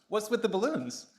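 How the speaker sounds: lightly voiced, curious royal question; mock Prince Charles. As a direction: playfully put words in a prince's mouth. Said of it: "Prince Charles came up to her and said".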